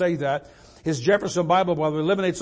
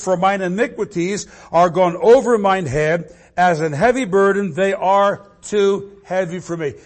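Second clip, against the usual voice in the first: scripture reading; 6 dB louder than usual. Acted. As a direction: read the psalm like a heavy lament. Reading psalm loudly.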